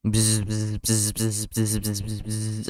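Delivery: playful spoken bee buzz; imitated bee buzz. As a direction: buzz like a bee in short bursts. Joke bee buzzing as Barry's speech.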